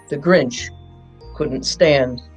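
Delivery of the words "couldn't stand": usual speaking voice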